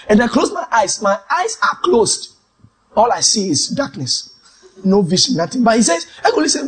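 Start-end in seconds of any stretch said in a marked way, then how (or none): none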